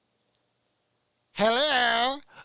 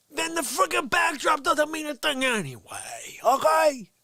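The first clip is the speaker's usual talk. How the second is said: ranting; 7 semitones higher, 3 dB louder, slower than usual. Real, not acted. Ranting about the backdrop critics.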